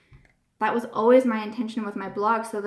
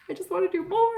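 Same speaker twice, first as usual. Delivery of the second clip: whiny high voice; 9 semitones higher, faster than usual. Retold or imitated. Herself retold, whiny.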